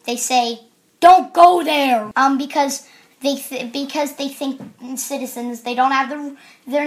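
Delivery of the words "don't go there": loud, slow, emphatic warning; mock-authoritative order, much louder; imitated warning. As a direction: boom out each word slowly. Voicing cops' warning.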